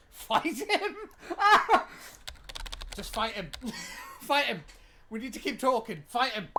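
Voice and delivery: loud laughing yells of "Fight him"; laughing shout. Real, not acted. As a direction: bellow it through laughter. Yelling at game dad, laughing.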